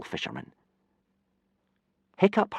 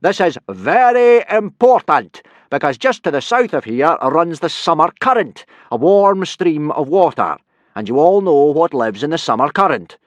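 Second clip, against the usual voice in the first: loud, higher blustering Viking; booming teacher; 9 semitones higher, 11 dB louder than usual. Acted. Viking teacher instructing boys in a booming voice. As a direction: lecture the lads at full volume. Gobber's voice held.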